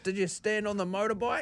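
put-on high, soft little-boy question; imitated young son. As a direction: ask it as an eager small child. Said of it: "he'll be like".

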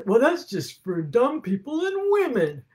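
mild mock condescension; mocking the culture. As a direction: sneer at it lightly. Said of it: "our culture says".

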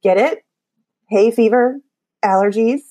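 ordinary talk, nothing marked

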